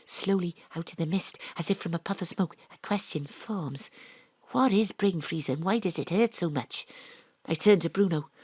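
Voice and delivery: ordinary delivery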